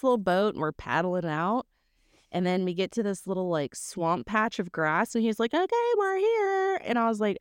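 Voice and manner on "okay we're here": very high singsong call; chirpy announcement. Retold or imitated. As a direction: imitate a cheery guide announcing arrival. Imitates friend announcing their arrival.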